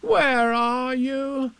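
put-on high, long-drawn boy's call. A narrator's reading. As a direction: call for a lost friend. Boy calls for missing Pooh.

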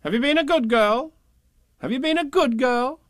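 high, loud sing-song question; mock grown-up sing-song; nagging grown-ups. A narrator's reading. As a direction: nag her in a sing-song.

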